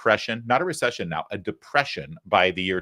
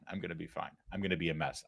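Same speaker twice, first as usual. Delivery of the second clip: much softer, lower, giving in; shaky quiet admission; 6 semitones lower, 14 dB quieter, faster than usual. Acted. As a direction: admit it to yourself under your breath. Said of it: "Little shaken up".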